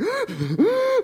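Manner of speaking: high sharp gasp for air; one sudden sob-like gasp for air. Retold or imitated. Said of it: "I'm gasping for air".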